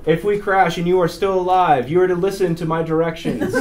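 imitated blunt safety instructions; flight crew briefing. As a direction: lay down the rules to a full cabin. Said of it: "He goes".

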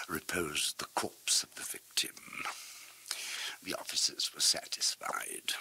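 breathy hushed whisper. A narrator's reading. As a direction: drop to a secret whisper. Hushed confession in horror tale.

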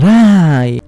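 mild acted cry of a name; shouting. A narrator's reading. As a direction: call out, drawing the name out. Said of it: "Sus amigos gritan". (grito moderado)

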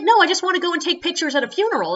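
naive, bubbly request; mock chirpy tourist. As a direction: rattle it off innocently. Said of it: "she's like".

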